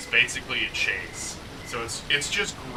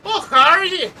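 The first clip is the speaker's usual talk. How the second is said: high, loud Scottish accent impression; squeaky over-the-top Scottish accent greeting; 10 dB louder than usual. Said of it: "[name] doing the Scottish accent".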